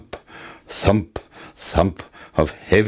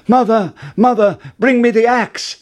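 high, loud urgent call; 12 semitones higher, 5 dB louder than usual. Acted. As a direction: shout down to mother urgently. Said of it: "called out".

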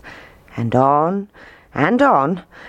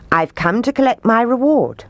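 higher-pitched, expectant announcement; claiming his due; 7 semitones higher than usual. A narrator's reading. Piper to the mayor at his door, claiming his due. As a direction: state the claim firmly, pitched up.

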